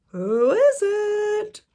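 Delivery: very high, slow quavering granny; old granny voice. Acted. Grandmother calling to the door, high and slow. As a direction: call it out like a frail old lady.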